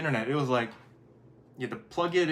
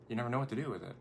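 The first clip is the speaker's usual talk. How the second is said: normal talking voice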